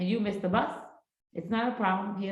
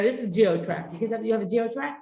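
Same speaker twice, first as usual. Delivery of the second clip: ordinary talk, nothing marked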